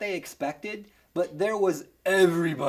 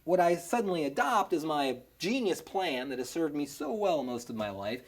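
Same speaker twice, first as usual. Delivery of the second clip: ordinary delivery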